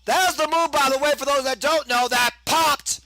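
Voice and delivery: high-pitched, excited ringside call; excited play-by-play. Acted. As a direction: call it like a live wrestling announcer.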